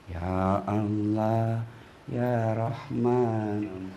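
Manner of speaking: husband's slow low murmur of praise; drawn-out hushed prayerful voice; slow soft praise. Acted. Tired husband murmuring thanks in bed, slow and low.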